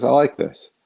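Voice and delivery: a hint of the mentor, pitched high; Neil Adams' approving voice. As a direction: lightly voice the older artist's approval. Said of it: "He goes".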